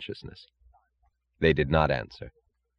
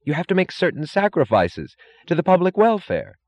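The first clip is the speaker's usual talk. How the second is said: high-pitched, righteous moralizing; earnest official; 12 semitones higher, 3 dB louder than usual. A narrator's reading. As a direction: preach duty in a high character voice.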